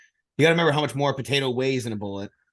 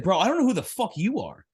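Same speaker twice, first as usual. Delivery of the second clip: cocky retort; 7 semitones higher than usual. Retold or imitated. Retold retort to husband.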